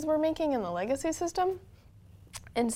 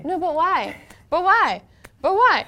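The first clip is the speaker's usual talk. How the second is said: high, loud whiny why; pestering child; 9 semitones higher, 9 dB louder, much slower than usual. Retold or imitated. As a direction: nag like a curious little kid. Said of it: "that five-year-old kid that's like".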